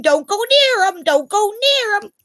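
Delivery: high, loud mock warning; jealous warning. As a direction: shriek it like a jealous girlfriend. Mocking a jealous girlfriend.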